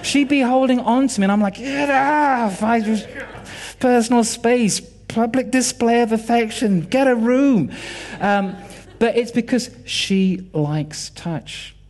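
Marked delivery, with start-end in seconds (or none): 1.5-7.7 mock-panicked, higher-pitched objection; hammed-up cry to be given space; exasperated protest. Husband fending off his clinging wife, comically exasperated.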